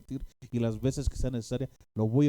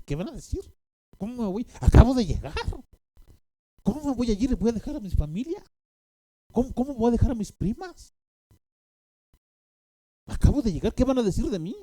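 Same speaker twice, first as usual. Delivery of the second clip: high, anxious protesting voice, much higher and louder; pleading with the Lord; 10 semitones higher, 5 dB louder than usual. Retold or imitated. Benny Hinn excusing himself to the Holy Spirit, high pleading protest. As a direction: beg off in a high fretful whine. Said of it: "me estaba diciendo a mí, "Señor, espérate".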